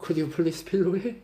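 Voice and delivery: slow, soft polite request; lightly voiced tender Jesus asking Peter; gentle pleading Jesus. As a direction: coax him kindly. Jesus asks gently for philo.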